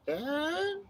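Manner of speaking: very high squeaky mock voice; dazed friend's voice. Knocked-out friend answering her rescuer, high dazed squeak. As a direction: squeak it out like a befuddled knocked-out fighter. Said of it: "have the Scottish accent knocked out of her".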